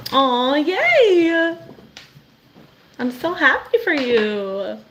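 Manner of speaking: high, bright cry of delight; delighted cheer. Real, not acted. Host cheering a viewer's small win, high delight. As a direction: cheer her on with joy.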